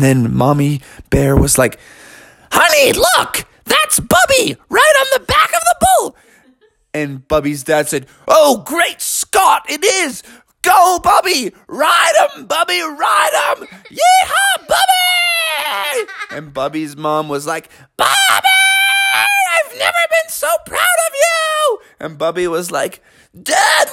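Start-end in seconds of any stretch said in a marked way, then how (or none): 2.5-6.2 much higher, breathy excited mom voice; high-pitched Mommy Bear. Acted. Mommy Bear spots her son. 8.3-16.1 much higher, shouting with laughter; a proud dad yelling from the stands; excited cheering dad. Acted. 18.0-21.8 proud mom. Acted.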